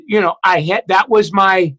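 normal talking voice

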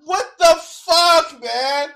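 exasperated disbelief. Real, not acted. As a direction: drag it out in disbelief. Exasperated at the clip.

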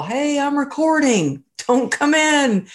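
high-pitched calling down the hall; mock yell. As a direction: imitate hollering to the household without full volume. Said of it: "like, yelling down the hall".

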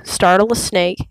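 ordinary delivery

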